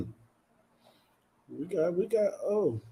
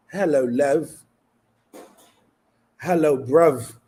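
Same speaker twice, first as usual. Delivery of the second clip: louder, cheeky mock English accent; 3 semitones higher, 6 dB louder than usual. Streamer greets South African viewers, mock English accent. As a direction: put on a London English accent for fun. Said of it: "English accent".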